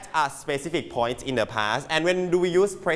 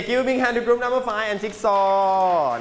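big drawn-out call for applause, raised pitch; announcer-like cheer; 6 semitones higher, much slower than usual. Real, not acted. Teacher calling for applause for a student's group, rousing cheer. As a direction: hype up the class for a winner.

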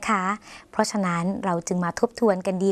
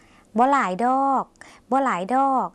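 slow, raised-pitch บ่หลายดอก with a long ดอก; slow demonstration, ดอก lengthened at the end; drawn-out final particle; 5 semitones higher, 3 dB louder, slower than usual. Shown as a demonstration. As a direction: model บ่หลายดอก slowly, stretching ดอก. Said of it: "ต้องเว้นวรรคคำว่า".

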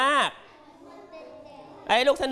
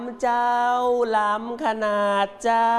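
slow northern Thai; 2 semitones higher, much slower than usual. Shown as a demonstration. Teacher models the women's version for the class, slow northern Thai lilt. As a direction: drawl out the polite women's form ending in เจ้า. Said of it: "ใส่สำเนียงช้าๆ เนิบๆ ไปด้วย".

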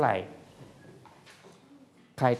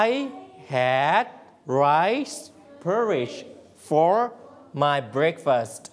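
word by word; much slower than usual. Shown as a demonstration. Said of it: "เราสามารถพูดเป็นภาษาอังกฤษได้ว่า".

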